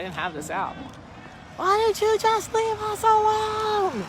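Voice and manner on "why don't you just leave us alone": high, loud shouted repeat; angry yell. Retold or imitated. Vlogger repeating the driver's loud high yell. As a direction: yell it again like the angry man. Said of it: "So he yelled".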